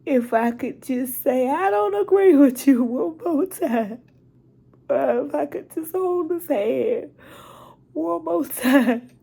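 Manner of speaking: pleading through real tears; near-wailing grief; crying. Real, not acted. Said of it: "I didn't mean to get emotional".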